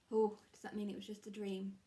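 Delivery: usual speaking voice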